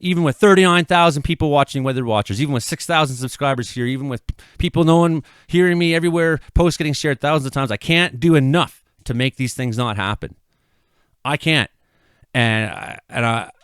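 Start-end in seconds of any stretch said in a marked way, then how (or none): 0.0-11.7 higher and louder, anguished venting; frustrated raised voice. Real, not acted. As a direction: let the frustration pour out loud. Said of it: "I'm screaming at it".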